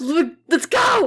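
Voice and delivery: high, drawn-out rallying yell; hyped-up shout. Pretend. Actor hyping host before recording, hyped-up shout. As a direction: stretch it out to pump up a friend.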